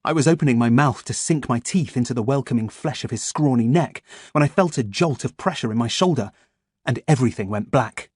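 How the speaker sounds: ordinary delivery, nothing marked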